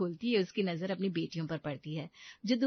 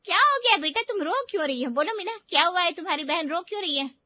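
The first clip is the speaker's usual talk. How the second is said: loud, high-pitched, urgent questions; worried, demanding to know what happened; alarmed mother; 10 semitones higher, 7 dB louder, faster than usual. Acted. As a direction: panic at finding your child in tears. Mother rushes to her crying daughter.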